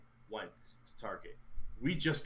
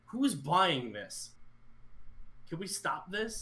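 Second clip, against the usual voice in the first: slow, high, disbelieving; exasperated; 7 semitones higher, much slower than usual. Retold or imitated. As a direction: drag it out in disbelief. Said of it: "I'm like".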